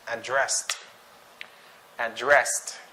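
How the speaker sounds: "addressed" with stress on DRESSED; light first syllable, strong DRESSED in "addressed"; verb stress. Shown as a demonstration. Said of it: "so we have to pronounce it as".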